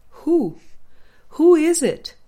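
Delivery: high, loud mock astonishment at the question; mock disbelief. Teacher mocks the student's belief with loud high astonishment. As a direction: act amazed that anyone could ask.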